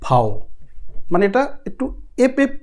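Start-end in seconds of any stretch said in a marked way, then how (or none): 0.1-0.3 "ফাও" as German V, pronounced "fow"; German letter V. Shown as a demonstration. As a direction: say "ফাও" with an f and ow glide. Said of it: "ভি মানে হইছে".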